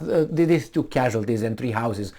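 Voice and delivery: usual speaking voice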